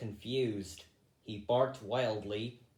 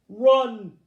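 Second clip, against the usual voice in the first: very loud, higher urgent shout; yell; 10 semitones higher, 13 dB louder than usual. A narrator's reading. Hazel yelling to the rabbits to escape, a loud shout. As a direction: yell at them to flee now. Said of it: "yelled Hazel".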